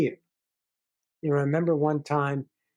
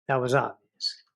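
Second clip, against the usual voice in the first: usual speaking voice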